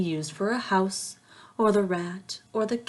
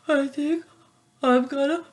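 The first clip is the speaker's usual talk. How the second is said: rising higher and louder toward a sneeze; building sneeze; 9 semitones higher, 10 dB louder than usual. Acted. Hog warning everyone he is about to sneeze, rising and louder.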